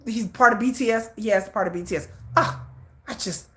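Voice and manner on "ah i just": soft, broken-off imitated stammer; shocked speechlessness. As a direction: mimic him lost for words, nearly voiceless. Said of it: "he was shocked".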